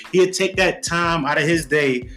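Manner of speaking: ordinary talk, nothing marked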